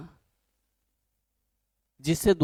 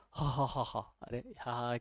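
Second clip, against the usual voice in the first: soft, mock forced "हा, हा, हा" laughing; fake laughter; 3 semitones lower, 11 dB quieter, slower than usual. Teacher mocking people forcing laughs in the park. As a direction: imitate a forced laugh-club laugh. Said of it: "नकली के हंसते हैं लोग".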